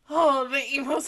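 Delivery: ordinary talk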